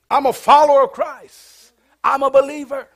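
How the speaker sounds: high, loud, showy declaration of faith; loud proclamation. Acted. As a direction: announce it to the whole street. Acted loud public profession of faith.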